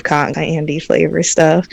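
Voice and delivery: real, unforced Southern US twang; southern accent slipping out. Real, not acted. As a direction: let a slight southern accent slip out. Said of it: "how bad the accent came out".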